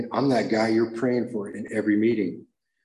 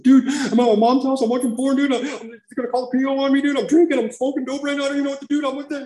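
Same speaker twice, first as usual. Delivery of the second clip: much higher, louder frantic rush; panicked confession; 7 dB louder than usual. Retold or imitated. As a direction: blurt everything out in panic. Frantic call to sponsor.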